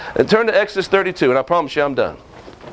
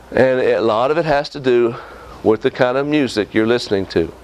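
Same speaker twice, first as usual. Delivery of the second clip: usual speaking voice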